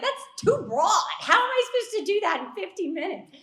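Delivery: high, loud retold outburst; exasperated mock protest. Retells her own alarmed protest.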